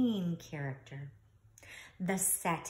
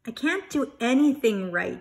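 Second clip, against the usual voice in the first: upset cry; 5 semitones higher, 11 dB louder than usual. Acted. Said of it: "he cried".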